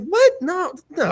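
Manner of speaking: high-pitched incredulous "what", dropping into denial; disbelieving retold protest; startled denial. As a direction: act out being wrongly accused. Retelling his startled denial.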